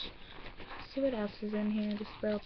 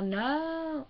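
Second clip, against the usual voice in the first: higher "No" playing up the accent; accented "No", pitched up; exaggerated accent; 5 semitones higher than usual. Shown as a demonstration. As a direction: lift "No" high to show the accent. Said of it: "Like, with an accent".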